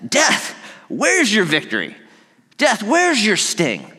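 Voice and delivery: high, slow, mocking challenge; Paul taunting death, slow and high. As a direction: dare death to answer. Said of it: "he's, like, taunting death".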